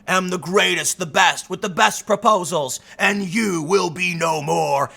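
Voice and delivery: drawn-out wrestling promo delivery; wrestler's ring boast. Acted. Trump boasting to the wrestling crowd, showman boast. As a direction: declare it slowly, like a ring showman. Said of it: "go up in that ring and say".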